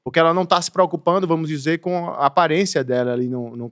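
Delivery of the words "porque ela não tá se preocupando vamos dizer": normal talking voice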